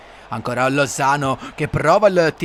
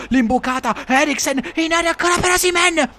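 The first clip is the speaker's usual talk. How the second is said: high, rising call; excited commentary; 12 semitones higher than usual. Acted. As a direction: call the chance.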